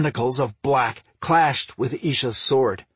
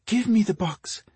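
higher, gentle wifely plea; tender request in a raised pitch; 6 semitones higher than usual. A narrator's reading. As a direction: coax him gently, voice lifted. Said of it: "requested in a gentle tone".

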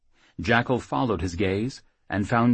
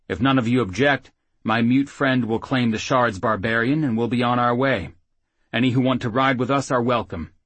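loud, higher rough-mannered half-orc; half-orc warrior voice; 4 semitones higher, 6 dB louder than usual. Acted. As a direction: speak up boldly to the room. Gripper speaks to the group.

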